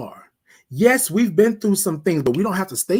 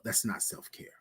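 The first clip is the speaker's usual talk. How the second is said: whispered refrain; 7 semitones lower, 16 dB quieter than usual. Real, not acted. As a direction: drop to a breathy whisper on the closing refrain. Soft refrain after emphatic point.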